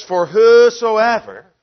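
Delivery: For whosoever, loud and very slow; slow, booming whosoever; emphasized whosoever. Shown as a demonstration. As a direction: lean hard on whosoever. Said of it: "we want to emphasize that".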